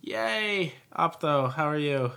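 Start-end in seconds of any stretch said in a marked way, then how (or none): 0.0-0.7 excited cheer. Real, not acted. Relief, the consultant has arrived.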